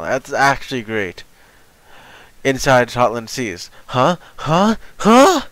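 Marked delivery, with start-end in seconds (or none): 3.9-5.4 rising incredulous huh; sharper, higher each time; surprised. Real, not acted. Rising confused reaction.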